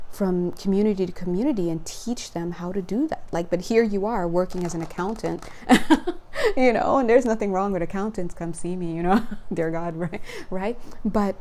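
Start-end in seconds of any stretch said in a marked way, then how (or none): 5.5-6.5 high burst of laughter. Real, not acted. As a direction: laugh out loud at the joke. Laughs at own joke.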